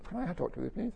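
mildly imitated tense request; mock-polite request for a word; loaded request. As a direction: ask for a talk with a lifted, wheedling "please". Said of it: "You know that way the people".

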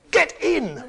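high, explosive troll shout; comically full-force yell; shouted command. Acted. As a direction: bellow an order to test your power. Troll tries out his shouted command.

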